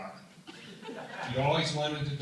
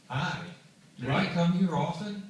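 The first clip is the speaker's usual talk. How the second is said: hammed-up sultry pickup line, louder and higher; 5 semitones higher, 6 dB louder than usual. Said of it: "he says in his most sultry voice".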